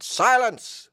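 high, loud command of "Silence"; a hidden voice hushing the crew, loud command. Acted. Mysterious voice silencing the crew, loud and commanding. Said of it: "said the voice".

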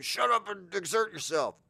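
high, insistent mock command; bossy trainer. Trainer overriding the client, high and bossy.

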